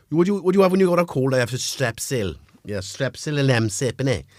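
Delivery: lilting Welsh Valleys accent; playful Valleys Welsh impression. Shown as a demonstration. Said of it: "that's a kind of Valleys accent".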